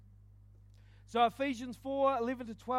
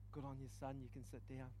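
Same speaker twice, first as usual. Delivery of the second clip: hushed voice of God; 9 semitones lower, 13 dB quieter, faster than usual. Retold or imitated. Imagined God's voice in his head.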